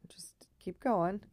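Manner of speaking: whispering. Real, not acted. Whispered pep talk to herself.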